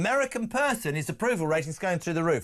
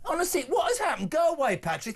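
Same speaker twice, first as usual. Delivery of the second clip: high-pitched mock outrage; exasperated, hammed-up protest; mock exasperation; 8 semitones higher than usual. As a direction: playfully send the caller packing, voice raised in pitch. Host mock-scolds caller over replayed jibe.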